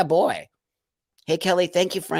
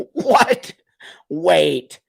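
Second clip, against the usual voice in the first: shocked what; 3 semitones higher, 6 dB louder than usual. Real, not acted. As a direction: blurt it out, stunned. Shocked reaction to a viewer comment.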